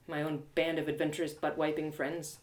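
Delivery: ordinary delivery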